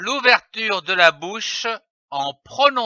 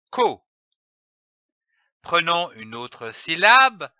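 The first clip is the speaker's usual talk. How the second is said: ordinary talk, nothing marked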